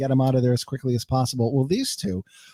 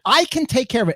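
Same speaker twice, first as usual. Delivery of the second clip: much higher, mildly indignant quote; retold yelling; 10 semitones higher, 3 dB louder than usual. Man retells his coworker snapping at him, mild high-pitched mock yell. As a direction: imitate her protest, pitched up. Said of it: "she kinda yelled at me".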